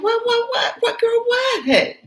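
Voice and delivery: put-on very high, eager girlish voice; giddy excitement. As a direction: Gina happily begging to see. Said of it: "So Gina's laughing, happy to see Ann".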